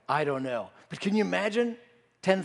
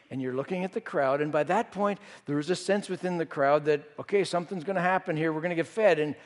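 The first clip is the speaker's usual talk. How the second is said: ordinary delivery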